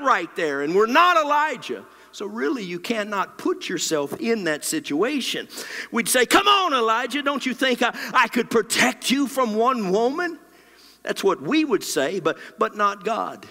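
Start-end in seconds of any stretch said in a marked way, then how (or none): 6.3-10.4 high, incredulous mock reproach; exasperated reproach. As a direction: challenge him in disbelief, pitched up. Acts our scolding of Elijah.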